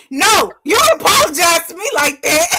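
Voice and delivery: sassy mock outrage; indignant retort. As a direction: put them in their place, half laughing. Mock retort to lazy apology.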